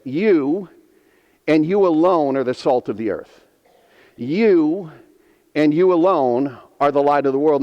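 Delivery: YOU and YOU alone, each you hit hard with a pause after; stress on you alone. Shown as a demonstration. Preacher to congregation, stressing you and you alone. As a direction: lean on you, then say alone firmly. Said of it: "it could be translated this way".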